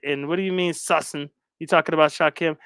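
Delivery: ordinary talk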